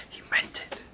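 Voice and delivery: conspiratorial whisper; whispered aside. Real, not acted. Game master whispering to listeners. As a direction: lean in and whisper the secret. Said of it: "whispered aside".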